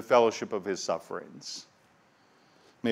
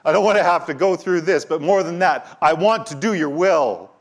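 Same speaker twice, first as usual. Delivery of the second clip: higher, louder anguished plea; pleading cry; 6 semitones higher, 6 dB louder than usual. Acted. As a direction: cry out "I don't want to have to go through this" as a desperate prayer. Same prayer repeated with intensity.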